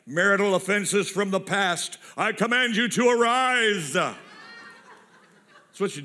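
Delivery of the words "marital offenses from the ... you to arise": slow, louder, grandly summoning the past; building to a mock shout of command; mock commanding incantation. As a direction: command old offenses to rise like a hammed-up sorcerer. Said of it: "you're out there like".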